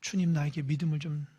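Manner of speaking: soft, low, humble confession; quiet humble reply to a rebuke; humble whisper. Shown as a demonstration. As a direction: whisper it like a contrite prayer. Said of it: "이렇게 반응하는 게 성경적".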